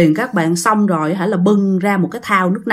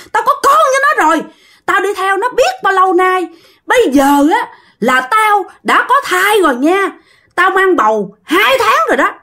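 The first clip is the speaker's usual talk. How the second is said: high-pitched, defiant spirit voice; possessing ghost wife; 9 semitones higher than usual. Acted. Ghost tells the master to stay out, high and hostile. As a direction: warn him off in a high, pushy voice. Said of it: "chú Tánh mới trả lời nè".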